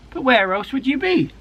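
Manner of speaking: amused mother. A narrator's reading.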